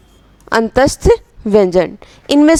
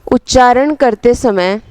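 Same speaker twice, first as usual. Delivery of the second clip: slow dictation, phrase by phrase with long pauses; measured words spaced out for note-taking. Real, not acted. Teacher dictating a definition for students to write, slow dictation.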